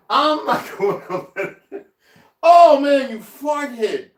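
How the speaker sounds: laughing it out, then a loud high burst at the cat; can't keep a straight face at the pet's mischief. Real, not acted. Laughing at the cat's mess.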